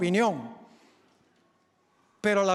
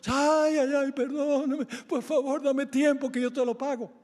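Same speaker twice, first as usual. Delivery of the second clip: high, whiny begging wail; mock tearful pleading; 5 semitones higher than usual. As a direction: act the crybaby begging for mercy. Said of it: "forma la llorona".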